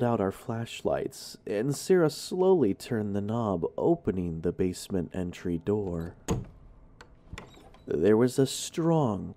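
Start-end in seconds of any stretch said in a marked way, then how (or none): none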